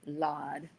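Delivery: "Lord." said as "Lawd", r dropped; Lawd pronunciation. Shown as a demonstration. Repeating her mother's "Lord" as "Lawd". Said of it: "L A W D, not L O R D".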